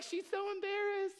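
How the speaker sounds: very high-pitched imitation of onlookers; gushing onlookers. Onlookers comment on the blushing woman, gushing in high voices. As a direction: squeal it like a cooing crowd. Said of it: "They're like".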